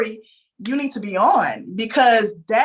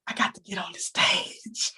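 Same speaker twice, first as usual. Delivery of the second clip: soft whisper, almost to herself; whispered dream; 3 semitones lower, 7 dB quieter than usual. Retold or imitated. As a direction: breathe it like a secret wish. Whispering her secret dream.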